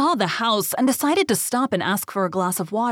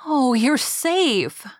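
slow, high-pitched cry of relief; mother's overjoyed exclamation; joyful relief; 6 semitones higher, much slower than usual. A narrator's reading. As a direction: draw it out, overcome with joy. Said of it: "she burst into tears of joy".

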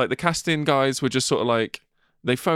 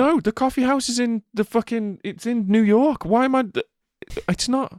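very high, indignant squawk; exasperated protest; 12 semitones higher than usual. Real, not acted. Podcaster arguing about a coffee house Siri mentioned, exasperated. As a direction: sputter in high-pitched disbelief.

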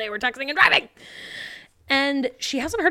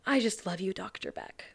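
real breathy whisper, much softer; 9 dB quieter than usual. Retold or imitated. Sophie hugs Doctor Beck and whispers, breathy. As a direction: breathe it into his ear. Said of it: "she whispers in his ear".

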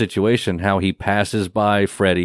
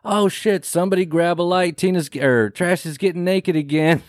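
alarmed; 7 semitones higher than usual. Retold or imitated. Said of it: "Chuck in the background going".

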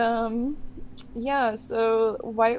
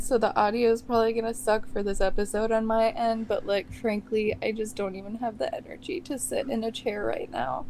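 usual speaking voice

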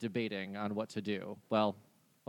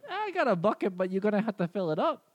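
higher, friendly reindeer voice; 8 semitones higher, 5 dB louder than usual. Acted. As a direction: play the chatty reindeer.

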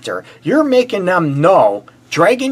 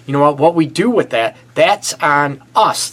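ordinary talk

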